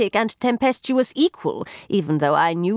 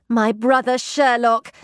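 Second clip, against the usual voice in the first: usual speaking voice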